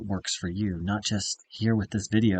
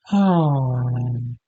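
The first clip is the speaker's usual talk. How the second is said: loud, falling "ohh" sigh; long open exhale with full voice; big audible sigh; 3 semitones higher, 9 dB louder than usual. Shown as a demonstration. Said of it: "let out a big sound".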